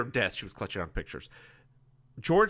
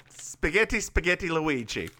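pitched-up cartoon Italian; mock Italian accent; 3 semitones higher than usual. Host clowns after the phone-call line, mock Italian accent. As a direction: list it off in silly Italian. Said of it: "That's a terrible Italian accent".

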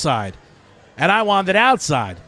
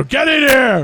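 boss yelling; 8 semitones higher, 4 dB louder than usual. Retold or imitated. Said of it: "my boss would open the door and go".

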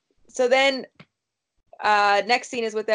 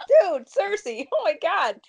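exasperated disbelief; 7 semitones higher than usual. Real, not acted. Podcaster groans at Cersei for trusting Littlefinger, exasperated. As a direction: groan at her foolishness, pitched up.

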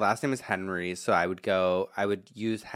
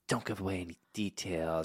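hushed warning; 4 semitones lower, 9 dB quieter than usual. Retold or imitated. Mother's warning in retold movie.

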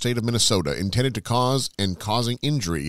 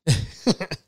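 laugh; 9 semitones higher than usual. Real, not acted. Laughing at own joke.

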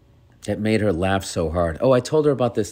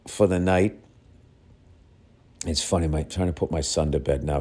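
ordinary delivery

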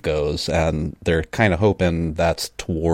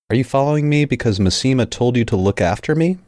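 higher-pitched, mildly insistent question; demanding; 7 semitones higher than usual. A narrator's reading. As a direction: read it as a pointed demand for an answer. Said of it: "she demanded".

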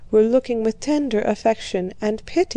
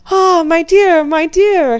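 put-on effusive old courtier; 8 semitones higher, 8 dB louder than usual. A narrator's reading. Vasili greets Mary effusively.

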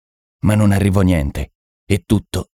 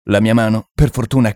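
usual speaking voice

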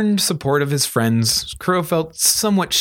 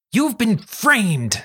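high, slow, drawn-out proclamation; Charlie, declaring; 9 semitones higher, much slower than usual. A narrator's reading. Girl declaring her theory at breakfast, high dramatic announcement. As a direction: announce it high, stretching the words. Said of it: "with a mouthful of waffled eggs".

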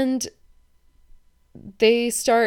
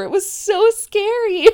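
excited; 12 semitones higher, 7 dB louder than usual. Real, not acted. Excited retelling of her outburst.

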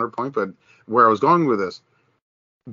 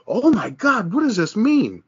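mock panic; 10 semitones higher than usual. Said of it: "the whole N F L is like".

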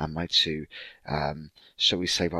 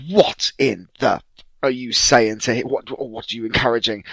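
loud outraged scold; appalled at her husband; scandalised grandma; 4 semitones higher, 7 dB louder than usual. Retold or imitated.

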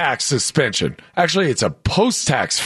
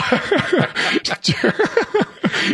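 breathless high-pitched laughter; high wheezing laugh; 12 semitones higher than usual. Real, not acted. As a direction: laugh hard, no voice. Bursts out laughing.

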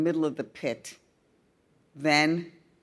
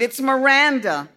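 put-on higher, louder pleading boy; whining boy; 7 semitones higher, 7 dB louder than usual. A narrator's reading. Boy pleading with his friend, whiny. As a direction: plead with a whine. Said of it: "Robbie whined".

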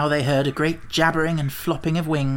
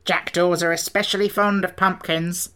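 growling Sawhorse; 3 semitones higher than usual. A narrator's reading. Sawhorse continues.

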